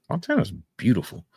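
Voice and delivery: normal talking voice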